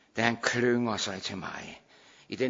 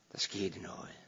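whisper; 4 semitones lower, 10 dB quieter than usual. Acted. Hushed telling of the miracle.

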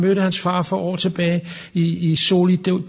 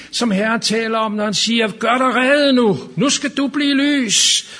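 higher, louder fervent preaching; preaching at full force; 9 semitones higher, 4 dB louder than usual. Acted. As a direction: build the revival cry about the light. Preaching climax about the light. (prædiken med fuld kraft)

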